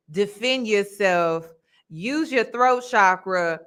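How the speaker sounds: ordinary delivery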